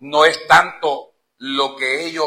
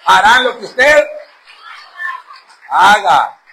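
preaching shout; 4 semitones higher, 8 dB louder, slower than usual. Real, not acted. Preacher repeating line louder.

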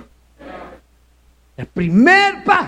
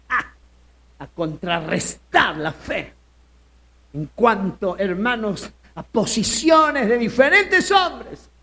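ordinary delivery

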